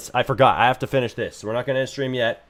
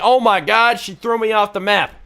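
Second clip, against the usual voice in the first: high, loud startled cry; real surprise; 8 semitones higher, 4 dB louder than usual. Real, not acted. Streamer reacts as the NPC hurls him away, startled yelp. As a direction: yelp as she throws you.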